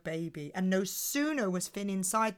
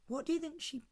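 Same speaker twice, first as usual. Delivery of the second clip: ordinary delivery, nothing marked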